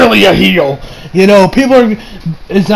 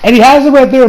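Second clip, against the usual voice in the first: normal talking voice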